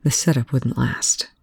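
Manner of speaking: usual speaking voice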